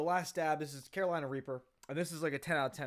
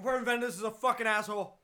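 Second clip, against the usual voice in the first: angry; 7 semitones higher, 5 dB louder than usual. Real, not acted. Burning from hot sauce.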